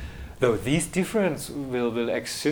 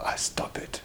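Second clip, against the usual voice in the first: whispered aside; 10 dB quieter than usual. Real, not acted. Gives up answering, aside.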